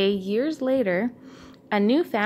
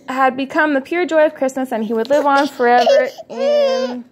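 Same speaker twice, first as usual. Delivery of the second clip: choked up; 4 semitones higher, 6 dB louder than usual. Real, not acted. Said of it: "okay, this is".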